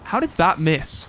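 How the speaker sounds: ordinary talk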